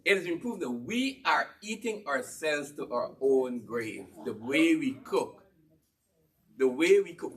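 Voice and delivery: usual speaking voice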